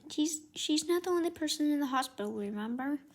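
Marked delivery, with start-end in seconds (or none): none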